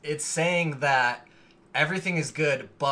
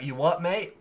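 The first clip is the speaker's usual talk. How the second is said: put-on a light go at a British accent, a bit higher; mock British accent; 4 semitones higher than usual. American friend trying out British slang in a mock British accent. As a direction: say it like a cheeky Londoner. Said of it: "Or you can say, like".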